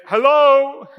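a sudden loud, high call into emptiness; mock searching call; calling out hello. Calling out for lost mom.

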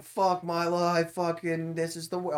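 mock outburst, drawn out; all-caps rant. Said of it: "all caps".

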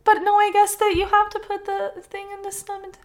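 high, upset voice, easing from loud; distressed, high-pitched objection; dismayed protest. Real, not acted. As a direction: protest as if it cannot be true.

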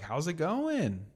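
high, drawn-out mock greeting; chatty stranger. Random coworker interrupting lunch, high drawn-out greeting. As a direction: imitate forced small talk, slow and high. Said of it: "some random person that you don't really ever talk to".

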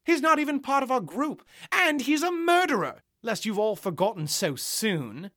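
furious protest. Acted. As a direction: refuse outright, building to high outrage. Chloe furiously objecting.

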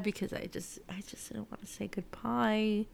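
soft whisper, barely voiced. Real, not acted. To viewers, whispering she didn't want goodbye. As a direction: confide it in a whisper. Sad about saying goodbye.

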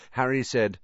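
ordinary delivery, nothing marked

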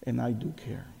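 a broken, humbled admission; head bowed, the young man confesses softly; quiet confession. Retold or imitated. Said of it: "He bowed his head and goes".

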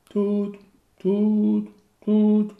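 high, loud imitated ringing tone; phone ring tones. Narrator imitating his old phone ringing.

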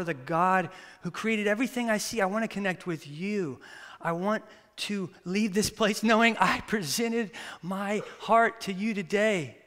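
ordinary delivery

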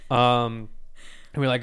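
usual speaking voice

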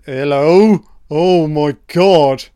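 loud, drawn-out shocked exclamation; startled outcry. Real, not acted. Sudden shocking scene on screen.

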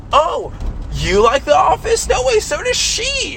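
mocking the annoying girl in the back seat, high and gushy; gushing girl imitation. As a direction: squeal it like a fake-friendly girl.

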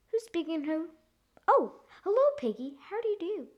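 high, polite telephone voice; lightly acted storybook lady on the phone. A narrator's reading. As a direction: greet the caller in a high voice.

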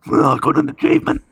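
high, silly imitation of a friend; exaggerated high-pitched impression; friend's voice impression. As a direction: mimic a mate announcing his achievement, way up high. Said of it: "that's, like, the voice".

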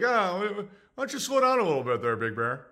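fast, friendly cop chiding lightly; easygoing cop. Pretend. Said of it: "It's like".

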